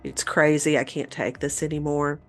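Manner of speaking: ordinary talk, nothing marked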